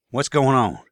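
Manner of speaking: ordinary delivery, nothing marked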